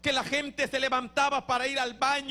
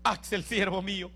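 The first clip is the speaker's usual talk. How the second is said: voice of God; 5 semitones lower than usual. Retold or imitated. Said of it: "una voz que me habla al oído".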